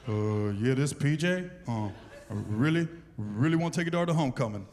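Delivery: put-on very low, halting teenage suitor; nervous giant kid asking permission, deep voice; deep teen boy voice. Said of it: "his voice is deeper than mine".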